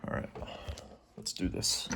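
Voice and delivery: whispered pep talk; whispering. Real, not acted. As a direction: hush it to yourself. Whispered psyching up.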